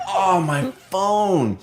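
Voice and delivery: high, slow dawning dismay; oh no, forgot it again; realizing dismay. Retold or imitated. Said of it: "I'm like".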